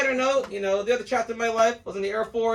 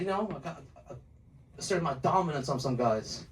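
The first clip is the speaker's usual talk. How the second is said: low-voiced ex-con; 9 semitones lower, 7 dB quieter than usual. Friend cagily confessing prison deeds.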